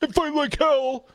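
high-pitched mock rallying cry; Donald Trump. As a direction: mimic a rally exhortation. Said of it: "Trump told election deniers".

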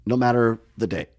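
ordinary delivery

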